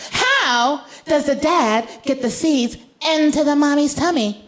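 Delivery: put-on high, slow child's voice; high little-girl interruption; 1 insistent little girl. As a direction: hold up like a tiny lawyer. Daughter voiced.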